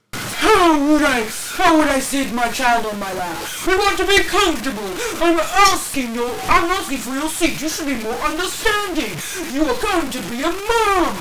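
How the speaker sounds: high, loud crying-edged rant; hammed-up sobbing Karen; whiny shouting entitled mom. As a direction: wail it like she's wronged. Entitled mom retake, whining.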